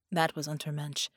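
plain reading voice

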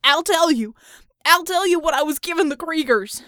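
high, loud desperate confession; tortured man yelling his surrender; gasping shout. A narrator's reading. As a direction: blurt it out loudly, gasping. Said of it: "as he gasped".

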